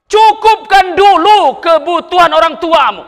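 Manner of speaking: high, loud fiery exhortation; loud preacher's command to care for parents; preaching shout. Real, not acted. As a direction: thunder it at the congregation. Preacher urging the congregation.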